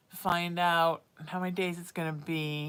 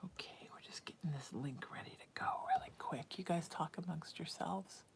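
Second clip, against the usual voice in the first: hushed aside while setting up the link; quick whispered aside to the audience; 10 dB quieter than usual. Real, not acted. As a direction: whisper it so viewers barely hear.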